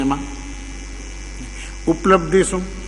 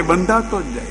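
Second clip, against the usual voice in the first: બંધાતો જ જાય dropped softer, falling away; fading echo; 6 dB quieter than usual. Acted. Preacher echoes softly to listeners that the soul keeps getting bound. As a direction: let બંધાતો જ જાય fade as a quiet echo.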